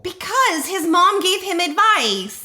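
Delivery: high, slow emphatic gush. Real, not acted. Co-host explains the adorable question, gushing. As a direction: gush it high, drawing out each word.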